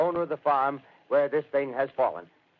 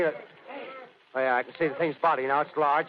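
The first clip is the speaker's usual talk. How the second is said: ordinary talk, nothing marked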